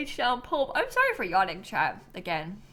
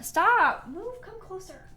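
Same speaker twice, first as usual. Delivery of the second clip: pet voice; 8 semitones higher, slower than usual. Real, not acted. Directing the cat on camera.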